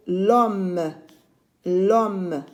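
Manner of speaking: "l'homme" said as one drawn syllable, silent h; elided l'homme. Shown as a demonstration. Teacher modelling elision for pupils, joined "l'homme". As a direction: run the l straight into the vowel of "l'homme". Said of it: "je dis l'homme".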